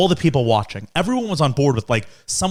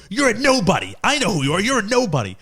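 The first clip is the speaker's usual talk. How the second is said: higher, mocking dismissal; dad mocks the girl, pitched up; dad's put-down; 7 semitones higher than usual. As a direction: brush her off as nobody. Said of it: "like, a zero".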